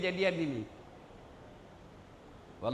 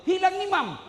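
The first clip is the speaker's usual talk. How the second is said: high, loud cry of alarm; retold shout; 12 semitones higher, 6 dB louder than usual. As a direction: mimic a startled yell to the whole room. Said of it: "Tiba-tiba teriak".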